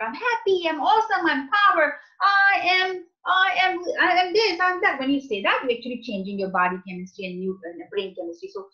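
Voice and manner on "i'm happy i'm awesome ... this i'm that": much higher and louder proud declaration; confident affirmations. Shown as a demonstration. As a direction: proclaim it with full power. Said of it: "when we say that".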